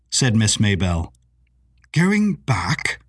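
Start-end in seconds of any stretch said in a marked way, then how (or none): 1.9-3.0 startled, rising question; surprised older gentleman voice; surprised exclaim. A narrator's reading. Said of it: "exclaimed Sir Shanley".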